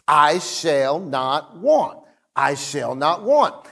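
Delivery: slow and deliberate, leaning on each word; slow repetition. Retold or imitated. Pastor repeating the verse to himself in his car. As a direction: repeat it slowly like a mantra. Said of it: "saying it to myself".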